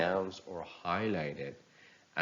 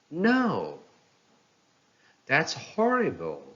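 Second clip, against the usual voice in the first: high, loud rise-fall of shock; rise-fall surprise; 8 semitones higher, 4 dB louder than usual. Shown as a demonstration. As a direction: sound stunned and dismayed at the news. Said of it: "showing surprise or sadness".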